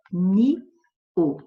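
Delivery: "Ni-o" split into two separate syllables. Shown as a demonstration. Teacher splitting "Ni-o" for the class, in two syllables. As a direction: break the hiato apart as "Ni-o". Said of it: "ó".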